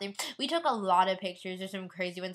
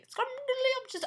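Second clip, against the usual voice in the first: Scrumdiddlyumptious squeaked much higher and a little slow; squeaky silly word; 13 semitones higher, slower than usual. Shown as a demonstration. Repeating the cute cake word Scrumdiddlyumptious in a much higher squeak. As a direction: pop Scrumdiddlyumptious up high and cute. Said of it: "That's cute".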